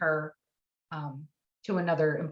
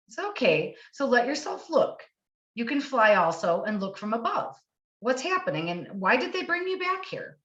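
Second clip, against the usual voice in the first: guiding voice; 6 semitones higher than usual. Retold or imitated. A hypnotist guiding her client through the vision. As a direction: coax her gently to look from above.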